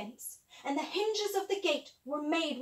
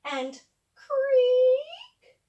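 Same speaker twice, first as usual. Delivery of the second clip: creaking gate; 10 semitones higher, 9 dB louder than usual. Acted. Gate sound.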